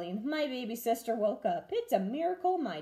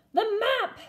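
much higher alarmed cry; pirate cry; 12 semitones higher, 5 dB louder than usual. A narrator's reading. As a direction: cry out in dismay. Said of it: "cried Braidbeard".

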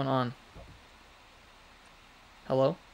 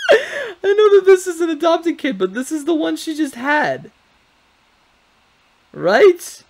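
much higher, loud, half shouting; excited shout; 14 semitones higher, 8 dB louder than usual. Real, not acted. Gamer reacts to the newborn, high excited shout. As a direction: yell it in disbelief.

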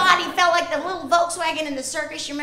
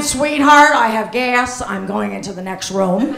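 loud, lower pull-string doll voice; talking doll; 5 semitones lower, 8 dB louder than usual. Pretend. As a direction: say it like a wind-up toy husband.

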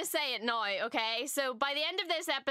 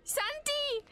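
high-pitched pleading cry; alarmed screaming; 13 semitones higher, slower than usual. Real, not acted. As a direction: cry out high to stop the fighter. Calling to Santi during the fight.

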